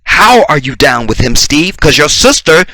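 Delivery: ordinary delivery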